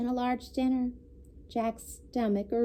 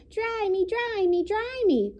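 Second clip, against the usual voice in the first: much higher pleading chick voice; whiny baby chick; 10 semitones higher than usual. A narrator's reading. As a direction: beg like a wet chick. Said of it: "cried the soggy baby chick".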